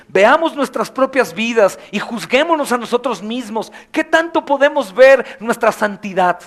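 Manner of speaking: loud rising exhortation; fervent preaching. Acted. Preacher pushing the church to judge itself, loud. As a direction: drive it home to the flock.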